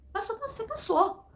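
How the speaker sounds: high, excited repetition; excited good news. Acted. Caller telling candidate she got the job, excited.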